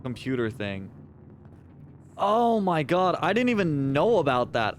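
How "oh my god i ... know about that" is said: shocked. Real, not acted. Shocked at missing roguelike buffs.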